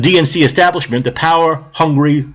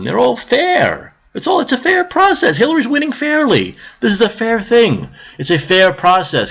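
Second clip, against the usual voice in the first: sing-song sarcasm, pitched way up; sarcastic; 8 semitones higher than usual. Acted. Mocking the DNC's claims of a fair process.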